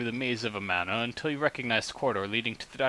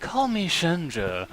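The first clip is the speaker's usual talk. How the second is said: slow, coughing croaky voice; hoarse voice; 5 semitones higher, much slower than usual. Acted. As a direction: croak it like a sore throat. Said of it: "a voice infection after she woke up".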